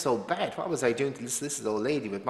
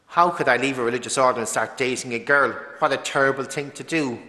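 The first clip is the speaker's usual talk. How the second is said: loud, emphatic outrage, building to the last word; mock condemnation; 2 semitones higher, 5 dB louder than usual. Voicing those who shunned a former religious for dating, condemning. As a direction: condemn like an accuser, louder on each blow.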